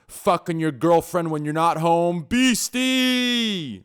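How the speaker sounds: loud, high announcer build-up; hype intro. Pretend. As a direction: hype the crowd like a ring announcer. Hype intro of next guest.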